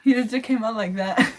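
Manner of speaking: loud laugh through the words. Real, not acted. Mom recalling the birth, laughing loudly. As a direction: burst out laughing.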